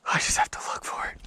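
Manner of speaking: whispered to self; breathy whisper under breath. Real, not acted. Muttering to self while searching.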